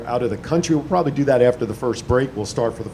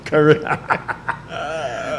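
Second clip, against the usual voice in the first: laughing; 3 dB quieter, much slower than usual. Real, not acted. Laughing at the name mix-up.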